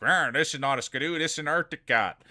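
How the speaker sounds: mock growling correction; imitated indignant snowmobiler. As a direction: play an offended snowmobile owner, lightly. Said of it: "they'd be like".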